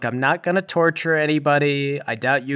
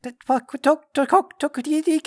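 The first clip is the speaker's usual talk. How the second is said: high, halting creature stammer; alien babble; 14 semitones higher, slower than usual. Acted. Alien captive babbling in unknown language.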